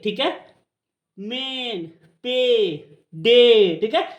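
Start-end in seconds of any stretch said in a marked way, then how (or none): 1.2-3.8 "मेन, पे, डे" with drawn-out ay; long ay sound. Shown as a demonstration. Teacher drilling the long ए, drawn-out "मेन, पे, डे". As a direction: stretch the vowel in "मेन, पे, डे". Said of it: "इसका लंबा उच्चारण जब आएगा".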